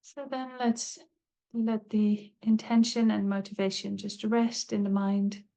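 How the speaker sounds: calm, unhurried meditation voice with long pauses; guided meditation. Acted. Teacher leading listeners into a body scan, calm meditative guiding. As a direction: guide them gently, leaving space between phrases.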